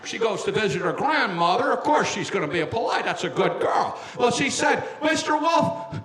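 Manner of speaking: folksy, hesitant leading-man voice; Jimmy Stewart. Pretend. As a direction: tell it like a stammering old film star. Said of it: "I like to do it as Jimmy Stewart".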